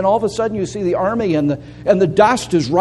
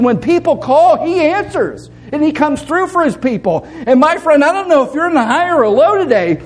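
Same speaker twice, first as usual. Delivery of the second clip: preaching at full force; 5 semitones higher than usual. Acted. Pastor urges the congregation to give thanks, preaching full out. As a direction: lift the congregation, ringing and urgent.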